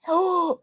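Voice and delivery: sharp high intake of breath; shocked gasp. Real, not acted. Vlogger seeing a nineteen to nothing loss, shocked gasp. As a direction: gasp at the score. Reacting to a 19-0 score.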